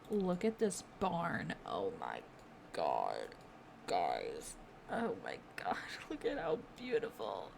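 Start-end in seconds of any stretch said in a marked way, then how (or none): 1.7-7.5 hushed whisper, half-laughing in awe; awed whisper. Real, not acted. Gamer whispering to viewers, overwhelmed by a pretty barn.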